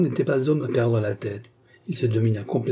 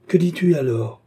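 angry question, pitched up; 6 semitones higher, 4 dB louder than usual. A narrator's reading. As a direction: demand an answer with a touch of anger. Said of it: "fit-il avec colère". (en colère, sans crier)

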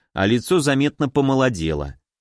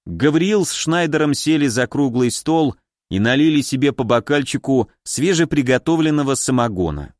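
ordinary delivery

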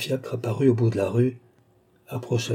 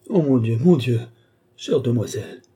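louder, distressed lament, understated sobbing; bursting into sobs; 3 semitones higher, 4 dB louder than usual. A narrator's reading. Servant hears that her mistress is missing, bursting into sobs. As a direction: wail it with a touch of tears. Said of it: "éclatant en sanglots".

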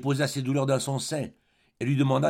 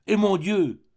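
higher and louder burst of surprise; astonished cry; 8 semitones higher, 6 dB louder, slower than usual. Acted. Colin spotting his old friend on the road, astonished cry. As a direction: call out in glad shock. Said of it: "s'écria-t-il".

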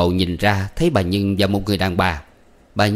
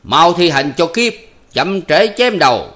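loud, higher, slowed stage command; booming theatrical shout; shouting order; 8 semitones higher, 5 dB louder, slower than usual. Acted. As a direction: bark the threat at the servant. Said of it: "Ông bầu quát".

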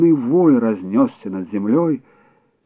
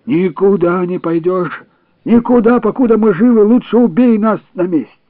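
high, loud begging cry; desperate pleading; 9 semitones higher, 6 dB louder than usual. A narrator's reading. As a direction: beg him not to go. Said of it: "старики взмолились".

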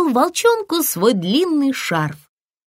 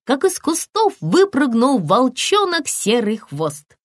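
ordinary delivery

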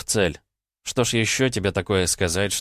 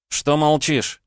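mocking high jab; teasing; 9 semitones higher, 4 dB louder, much slower than usual. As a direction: needle him playfully. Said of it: "поддел я алтарь".

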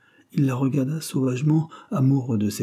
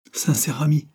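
higher, rising incredulous question; indignant echo; 6 semitones higher than usual. A narrator's reading. Girl bristling at his claim of friendship, indignant echo. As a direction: throw it back as an indignant question. She throws his words back.

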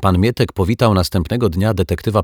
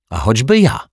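louder and higher outburst; shouted; 5 semitones higher, 5 dB louder than usual. Acted. Detective cries out against the zombie, loud and high. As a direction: fling it right back at him. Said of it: "krzyknął detektyw Pozytywka".

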